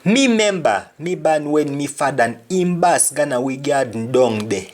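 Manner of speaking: lively Jamaican patois demo. Shown as a demonstration. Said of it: "Another example".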